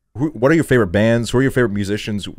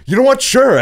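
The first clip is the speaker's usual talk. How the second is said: much higher and louder, gleeful; eager yes; 8 dB louder than usual. Retold or imitated. Offered a hit in California, a gleeful high yes. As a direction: jump at the offer like a kid.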